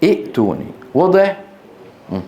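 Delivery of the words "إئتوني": إئتوني with short i and a glottal stop; original hamza form. Shown as a demonstration. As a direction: stop briefly after the first i of إئتوني. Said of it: "أصلها إيش؟". (نطق «إئتوني» بهمزة ساكنة محققة)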